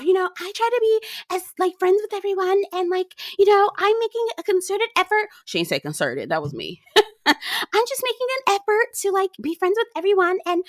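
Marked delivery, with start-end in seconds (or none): 0.0-5.3 high, breathy-free mocking falsetto of Larsa. Mocking Larsa's words to viewers in a high put-on voice. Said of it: "she talking, running her mouth". 7.3-10.6 high, breathy-free mocking falsetto of Larsa. Mocking Larsa's words to viewers in a high put-on voice. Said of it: "she talking".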